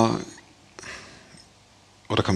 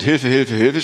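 mock cry for help; 2 semitones higher, 5 dB louder than usual.

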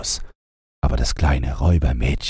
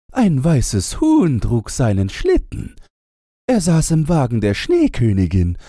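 cooing wood doves; 14 semitones higher than usual. A narrator's reading. Wood doves tell Gretchen about Karl, high cooing voice. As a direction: coo it like a dove telling sad news.

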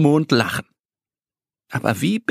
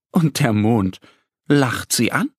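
ordinary delivery

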